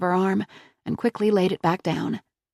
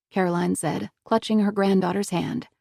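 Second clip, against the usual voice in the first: ordinary delivery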